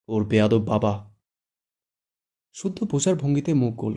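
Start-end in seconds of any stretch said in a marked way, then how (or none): 0.1-1.1 slow, pressed words through clenched teeth; through gritted teeth. Acted. Shuddho spits out the insult about the father through gritted teeth. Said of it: "শুদ্ধ দাঁতে দাঁত চেপে বলে".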